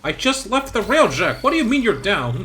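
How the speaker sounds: high-pitched disbelief; exasperated. Real, not acted. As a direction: yelp of outrage at a teammate. Teammate keeps dying in game.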